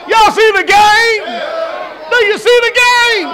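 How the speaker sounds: shouting out. Real, not acted. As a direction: holler the question to the whole room. Shouting question at congregation.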